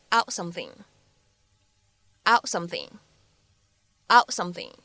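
"out something" with the t of "out" held back; incomplete plosion. Shown as a demonstration. Teacher demonstrating incomplete plosion, "out something" with unreleased t. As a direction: stop the t unreleased before "something". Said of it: "不完全爆破的处理".

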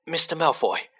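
a touch of breathless urgency; gasping. A narrator's reading. Harry skidding to a halt before Malfoy, mildly gasping. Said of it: "he gasped".

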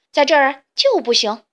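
loud, stern refusal; strict, forceful ban. A narrator's reading. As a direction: lay down the law firmly and loudly. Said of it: "鸭子严厉地说".

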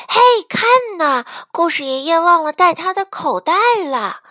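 high, loud chick calling attention; high-pitched excited call; excited shout. A narrator's reading. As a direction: shout to the others to look. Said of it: "卡门喊道".

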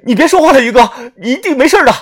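high, loud, fast tearful urging; loud plea. A narrator's reading. Narrator begs wounded brother Yu to stop talking, loudly. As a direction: shout it to keep him going. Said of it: "大声地说道".

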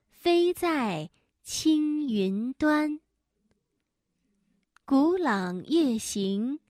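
slow, soft classical recitation; poem recitation. Acted. Reads Tang poem slowly.

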